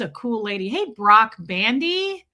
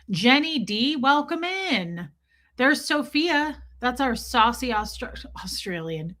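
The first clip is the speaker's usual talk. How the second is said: usual speaking voice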